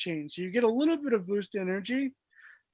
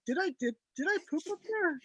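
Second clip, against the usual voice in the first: incredulous wondering; 9 semitones higher, 5 dB quieter, faster than usual. Retold or imitated. Retold self wondering.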